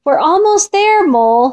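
high reassuring call; mild shout back. A narrator's reading. Fox answers Mole below, mild shout. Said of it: "shouted Fox".

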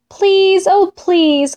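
high, drawn-out begging; pleading. A narrator's reading. Fox and Mole beg Bear to climb, pleading. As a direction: plead sweetly for a favour.

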